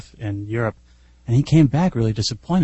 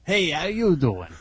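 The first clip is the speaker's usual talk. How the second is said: drawn-out, higher mock New Jersey accent; fake New Jersey accent; 6 semitones higher, slower than usual. Kid in the plane seat taunting passenger, fake New Jersey accent. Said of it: "in a fake New Jersey accent goes".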